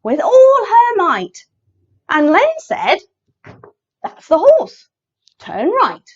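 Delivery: animated picture-book narration with big pitch swings; story read to children. A narrator's reading. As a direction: read it playfully for little listeners.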